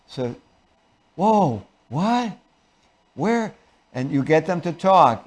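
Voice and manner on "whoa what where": high, hushed, short questions; acted worried alarm. Shown as a demonstration. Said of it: "remember, you're using fear".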